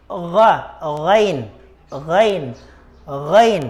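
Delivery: ghain with a gargled G H from the back of the throat; Arabic ghain. Shown as a demonstration. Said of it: "Ganoon po ang pagbigas sa kanya". (ghain na may garalgal na G H sa lalamunan)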